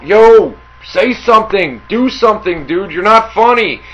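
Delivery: mock crowd shout; imitating yelling heckler. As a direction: call out like an impatient audience member. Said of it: "people in the audience that are yelling out".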